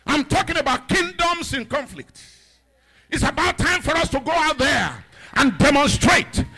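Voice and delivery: loud, chanted, slow preaching shouts; preaching at full force. Acted. Preacher declaring victory to the congregation, full-force preaching. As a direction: drive the congregation into a roar.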